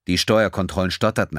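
ordinary delivery

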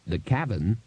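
ordinary delivery, nothing marked

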